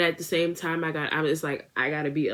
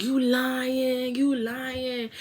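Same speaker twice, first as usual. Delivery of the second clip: disbelieving friends; 8 semitones higher, much slower than usual. Retold or imitated. Friends disbelieve the bed wetting.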